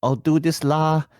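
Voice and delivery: playful Singlish accent impression. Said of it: "they will always just go".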